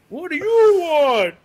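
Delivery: high, loud, slow cartoon Santa bark; hammed-up jolly voice gone sour; mock Santa voice. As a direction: play the put-upon Santa, booming it out. Santa voice to greedy worker.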